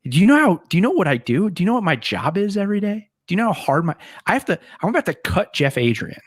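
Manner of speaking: high, rising exasperated tirade; mock indignant rant. Coach lecturing him about his job, high indignant rant. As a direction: ham up the coach's outrage.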